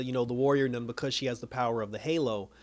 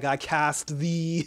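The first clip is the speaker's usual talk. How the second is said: mock-solemn spell, drawn far out; dramatic incantation; 2 semitones higher, much slower than usual. Recapper quoting the heroine banishing a demon, drawn-out incantation. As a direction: stretch it out like casting a spell.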